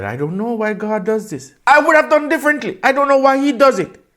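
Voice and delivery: high, loud indignant complaint; mock protest. Preacher mimics people questioning why God does this, high-pitched protest. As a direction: blurt it out high, as a baffled objector.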